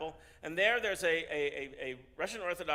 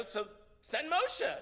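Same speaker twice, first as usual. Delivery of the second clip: drawn out, much higher, insistent; pointed retort; 11 semitones higher, 3 dB louder, much slower than usual. Acted. The delegation throwing the rabbi's answer back, drawn out and high. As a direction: stretch each word, making the obvious point.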